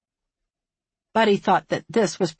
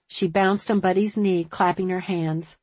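usual speaking voice

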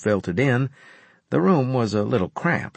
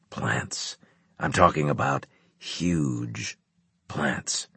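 low, hushed, deliberate officer voice; grave commander explaining; 5 semitones lower, 5 dB quieter, slower than usual. A narrator's reading. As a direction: spell it out low, with pauses.

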